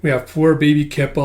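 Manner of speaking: plain reading voice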